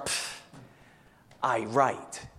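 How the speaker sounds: dismissive puff of air, then scornful words; scoffing. Shown as a demonstration. Preacher showing church what scoffers sound like, scoffing. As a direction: scoff as if unconvinced. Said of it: "That's scoffing? Is that a fair definition?".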